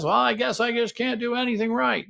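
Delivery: high, self-pitying sigh of defeat; defensive whine. Shown as a demonstration. Partner answering a complaint with defensive self-pity. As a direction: play the wounded partner giving up. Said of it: "See the defensiveness?".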